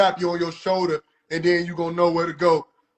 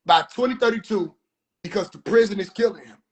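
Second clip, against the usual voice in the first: normal talking voice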